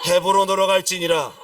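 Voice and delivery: loud, firm decree; emphatic divine command. Acted. God answering a hesitant David, emphatic command. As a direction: pronounce the command forcefully. God's command cuts off the pleading.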